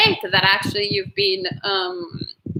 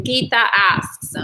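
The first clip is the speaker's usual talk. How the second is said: normal talking voice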